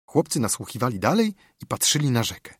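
ordinary delivery, nothing marked

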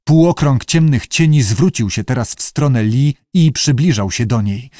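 ordinary delivery, nothing marked

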